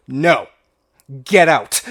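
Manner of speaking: pointing and shouting him out the door; retold shout. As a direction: throw him out with the voice. Manager throws customer out.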